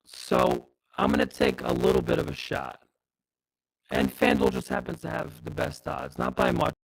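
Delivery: choppy robotic audio lag; glitchy robot-sounding connection. Real, not acted. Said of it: "Robot voice, [name]".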